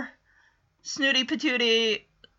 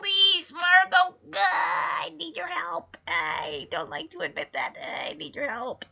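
mock pleading, breaking into a fake sob, then much softer; hammed-up begging; 3 semitones higher, 7 dB quieter than usual. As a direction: play Punky begging with a whiny half-cry. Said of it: "she's begging".

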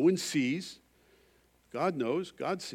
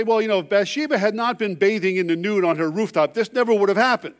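high, loud, whiny excuse-making; mock excuse; 7 semitones higher, 9 dB louder than usual. An imagined David pleads his excuse, mock whiny. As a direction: shift the blame in a put-on whine. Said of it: "he doesn't say".

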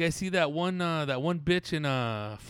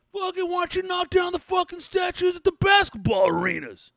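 furious shout; 14 semitones higher, 7 dB louder than usual. Acted. Ranting character vents at protesters.

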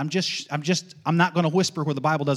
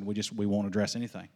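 normal talking voice

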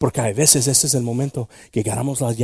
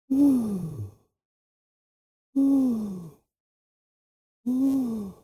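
rising ooh; 9 semitones higher, much slower than usual. Real, not acted. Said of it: "congregation moment".

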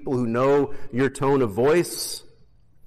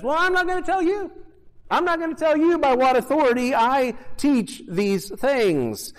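much higher, teasing mock refusal; sing-song refusal with a smirk; playful ironic brush-off; sarcastic Jesus; 12 semitones higher than usual. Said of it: "hear the sarcasm in his voice".

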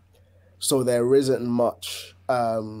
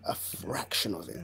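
under breath; 2 semitones lower, 11 dB quieter than usual. Real, not acted. Repeats the point under breath.

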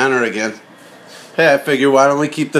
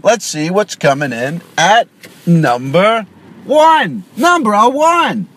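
countdown announcer; 2 semitones higher, slower than usual. Pretend.

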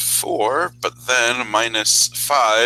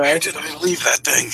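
higher, searching in a fluster; flustered character voice; 5 semitones higher than usual. Acted. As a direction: mutter like a lost-sword hero. Character searching for lost sword.

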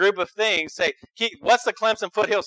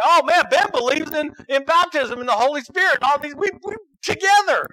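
high, bright-eyed sarcastic gush; mock gushing churchgoer; 9 semitones higher, 5 dB louder than usual. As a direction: parody someone happily approving a fellow believer. Mocking churchgoers accepting a brother.

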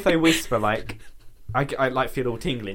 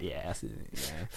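resigned; 11 semitones lower, 14 dB quieter than usual. Retold or imitated. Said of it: "and I'm like".

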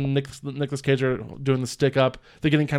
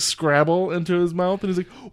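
ordinary talk, nothing marked

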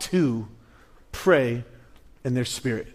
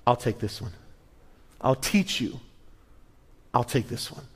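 quiet resolve; 4 semitones lower, 5 dB quieter than usual. Retold or imitated.

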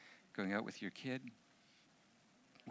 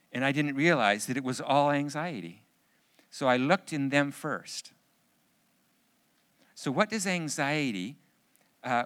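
louder, climbing preacher's push; preaching at full force; 4 semitones higher, 8 dB louder than usual. Acted. Pastor urging the men about anxiety, louder preaching. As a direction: drive it home to the room, building.

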